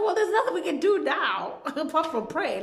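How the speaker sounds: high, loud laughing mimicry; mocking through laughter. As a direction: laugh your way through a mock excuse. Mimics helpless people, laughing.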